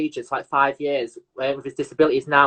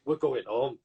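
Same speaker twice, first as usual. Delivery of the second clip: a low, softer, tearful going home; mock tearful; 5 semitones lower, 8 dB quieter than usual. Friend imitates him saying they're going home, mock tearful. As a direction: mimic a near-crying friend. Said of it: "You're like".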